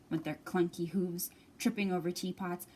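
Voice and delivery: ordinary delivery, nothing marked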